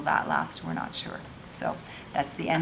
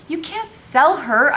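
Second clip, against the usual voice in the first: higher, louder, slow pleading protest; bursting out; 10 semitones higher, 9 dB louder, slower than usual. Acted. Isabel protests Madam selling her sister, bursting out. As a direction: blurt it out in desperation. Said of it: "I burst out".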